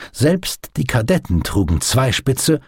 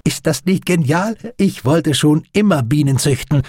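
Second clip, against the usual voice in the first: high, eager, lively chatter; delighted enthusiasm; 7 semitones higher than usual. A narrator's reading. Doctor shows friend his glass beehive, excited. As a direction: gush proudly over a new treasure.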